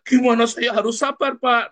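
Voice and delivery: high-pitched, exasperated outburst; mock angry retort. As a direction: fire back in acted indignation. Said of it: "Marahnya sama kamu malah".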